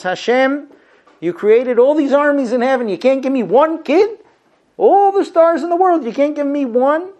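high, loud cry to heaven; anguished plea. Retold or imitated. Childless woman pleading with God in the temple, loud and high. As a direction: demand it of God with great fervor.

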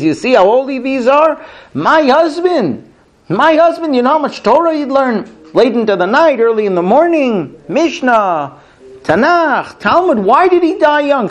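high, aching plea of a grieving widow; pleading widow. Retold or imitated. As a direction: plead like a bereaved wife, voice rising. Widow pleading, voiced in story.

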